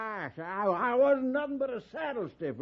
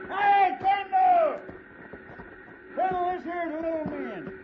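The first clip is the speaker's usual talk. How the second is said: calling out; 8 semitones higher, 3 dB louder than usual. Acted. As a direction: shout across the yard, then introduce. Calling across the railyard.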